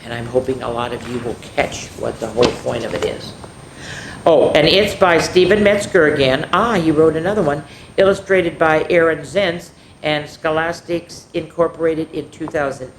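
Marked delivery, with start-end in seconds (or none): none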